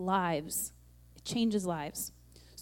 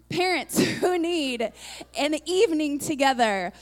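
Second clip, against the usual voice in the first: higher and louder, laughing through it; laughing excitement; 5 semitones higher, 6 dB louder than usual. Real, not acted. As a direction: bubble over with laughter as you pitch it. Excited announcement to the congregation.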